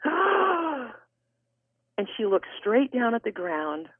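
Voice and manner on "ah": big high-pitched shocked gasp; huge gasp. Retold or imitated. Stunned cashier hearing the testimony, huge gasp. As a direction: suck in a huge breath, imitating shock. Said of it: "took a huge breath, and she just went".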